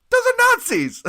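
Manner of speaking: very high, loud alarm; panicked mock shriek. Musician warns the other guys about Nazis, panicked shriek. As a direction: shriek the warning in comic panic.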